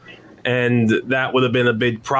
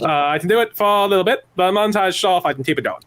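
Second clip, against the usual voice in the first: a light Connecticut accent, quick and higher; 5 semitones higher, faster than usual. Shown as a demonstration. Actor shows his Connecticut accent on request, light Connecticut accent. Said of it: "that is the Connecticut-based accent".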